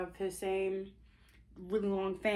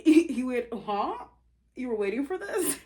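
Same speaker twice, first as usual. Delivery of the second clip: laughing disbelief; 6 semitones higher, 8 dB louder than usual. Acted.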